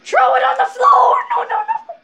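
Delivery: very high, loud frantic shout; urgent yelling. Real, not acted. Player urging a throw mid-game, high frantic shout. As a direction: yell it in a rush of alarm.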